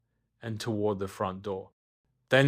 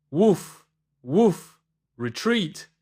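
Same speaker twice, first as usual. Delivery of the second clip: loud higher barks then a shout; robot dog yelling; 7 semitones higher, 6 dB louder, slower than usual. Acted. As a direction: sound the alarm like a toy dog. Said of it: "yelled Robodog".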